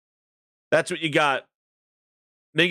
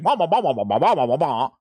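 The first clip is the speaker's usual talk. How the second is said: babbling nanny; 5 semitones lower, much faster than usual. Retold or imitated. Imitating the nanny who only talks in tongues, babbled nonsense. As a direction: run syllables together in sing-song gibberish, sinking low. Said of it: "the nanny who only talks in tongues".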